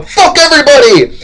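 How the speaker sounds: high hammed-up holler; defiant shouting. Colonists answering the exasperated British, high defiant yell. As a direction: yell it at the whole world. Colonists defy everyone in war.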